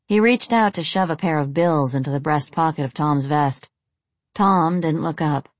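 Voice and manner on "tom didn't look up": ordinary delivery, nothing marked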